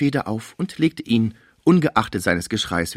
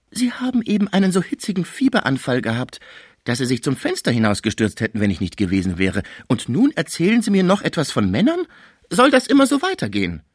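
indignant scolding that climbs high at the end; exasperated scolding; 6 semitones higher than usual. A narrator's reading. Caretaker telling her patient he was delirious, exasperated scolding. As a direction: scold as if sorely tried, pitch jumping up.

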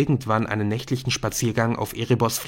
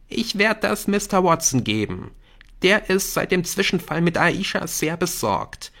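put-on higher, determined young friend; earnest schoolboy; 7 semitones higher than usual. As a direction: lay out the plan with concern. Jamie's earnest plan in the story. (ernsthaft besorgter Schüler)

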